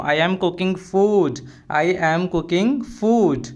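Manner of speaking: slow, carefully spelled-out English phrase; slow and clear classroom model phrase; slow teaching demo. Shown as a demonstration. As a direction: say each word slowly for the learner. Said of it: "अब बोला कि".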